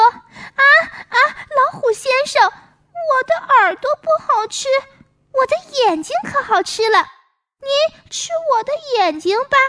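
pitiful plea. Pretend. Squirrel's pleading voice again.

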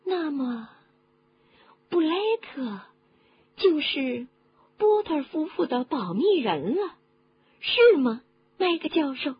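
high-pitched, halting questioning voice; curious woman listener. A narrator's reading. Woman checking with the professor who the secret-keeper was, high and questioning. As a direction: piece it together aloud in a high voice.

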